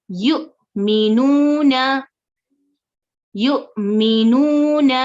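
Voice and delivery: फ़तू very slow, with a small stop before तू; tiny jolt in the middle of फ़तू; slight catch on hamza. Shown as a demonstration. Teacher shows students the stop at hamza sakina. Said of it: "हमजा पर झटका".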